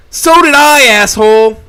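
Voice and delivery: loud, high, furious scream; furious shout at the boss. A narrator's reading. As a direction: scream it at him with everything. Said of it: "I shout at him".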